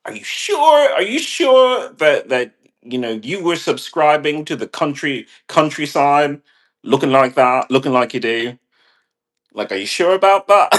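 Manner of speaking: high, loud incredulous questioning; mock disbelief. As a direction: challenge him in exaggerated doubt. Acted disbelieving reaction to actor.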